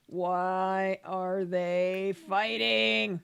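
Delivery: slow, drawn-out mock child's lament; playacted slow, loud complaint; dismayed child. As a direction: stretch each word out loudly like an upset kid. Voicing child watching parents argue.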